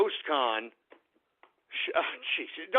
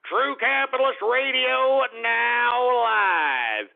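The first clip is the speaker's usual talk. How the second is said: slow, drawn-out radio station ident; announcer voice; 4 semitones higher, slower than usual. Acted. Host announcing his show, slow announcer voice. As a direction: boom out the show name like a jingle.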